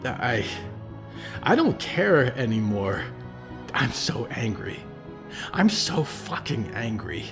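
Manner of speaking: put-on a furious young man venting his pain; rising anger. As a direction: let the rage boil over, then grind it out. Percy rages at the cult.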